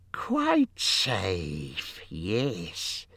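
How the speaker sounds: slow, hissy whisper to oneself; whispering creature. A narrator's reading. Creature whispers to himself that he is safe, sly whisper. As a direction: hiss it half-whispered, like a creature talking to itself. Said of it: "he whispered to himself".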